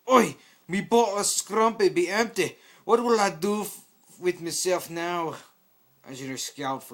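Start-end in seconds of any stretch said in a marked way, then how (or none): 0.1-5.4 higher, louder slow slurred lament; drunken Scotsman. Acted. Demoman interrupting Engineer, drunk and loud. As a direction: wail over the empty bottle.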